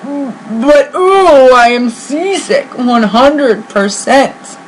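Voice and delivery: slow, queasy elephant voice, drawn out; seasick Horton. Acted. Horton moaning to himself about the sea, slow and queasy. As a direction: groan it out like a seasick elephant on a bobbing ship.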